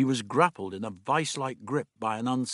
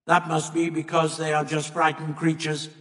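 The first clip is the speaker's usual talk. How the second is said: higher, level monotone alien; monoid voice; 5 semitones higher, 4 dB louder than usual. A narrator's reading. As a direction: speak on one raised note like an alien. Monoid character speaking in the story.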